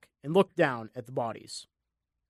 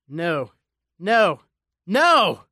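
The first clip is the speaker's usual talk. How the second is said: screaming; 5 dB louder, much slower than usual. A narrator's reading. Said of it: "Jack screamed".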